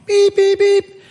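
high, loud beeping like a car horn; imitated car horn. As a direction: honk out each beep high and loud. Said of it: "car honking".

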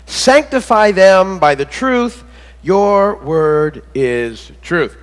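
very slow, each word weighted; emphatic verse. Shown as a demonstration. Pastor repeats the verse to congregation, slow and weighted. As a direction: lean on "Sanctify" and draw out "Your word is truth". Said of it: "dig into that seventeenth verse".

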